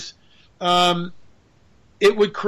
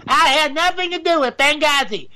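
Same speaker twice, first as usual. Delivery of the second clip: higher, put-on Hillary voice; Hillary Clinton impression; 8 semitones higher than usual. Shown as a demonstration. Fixing a wrong accent, trying a Hillary voice. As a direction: take another shot at sounding like Hillary. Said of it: "I can't do Hillary Clinton".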